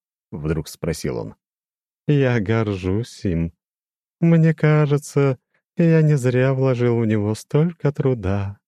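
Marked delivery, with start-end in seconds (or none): 2.1-8.6 high, slow, chiming demon voice; lofty demon with a glassy ring; crystal ringing voice. A narrator's reading. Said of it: "прозвенел он". (высокий звенящий хрустальный голос демона)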